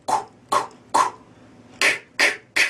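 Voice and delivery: "کو" and "ک" as clicky unvoiced rimshots; sharp dry click on "ک". Shown as a demonstration. Teacher showing the snare rimshot to students in dry clicks. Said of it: "کو یا مثلاً که جفتش یکیه".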